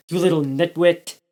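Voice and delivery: a touch of angry yelling; mild shout. A narrator's reading. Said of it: "Robbie shouted".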